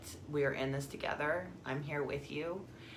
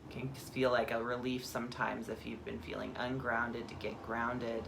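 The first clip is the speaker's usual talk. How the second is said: guided meditation; 2 semitones lower than usual. Acted. Guide grounds listeners from head to earth, calm meditation voice. Leads a guided body scan.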